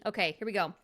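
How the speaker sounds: usual speaking voice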